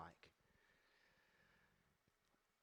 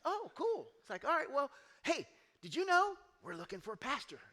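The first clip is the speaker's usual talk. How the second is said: high, loud breezy pitch; mock offhand recruiter; casual sales pitch; 13 semitones higher, 11 dB louder than usual. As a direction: slip the job offer in casually. Church guys casually pitching job.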